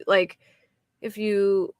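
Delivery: ordinary talk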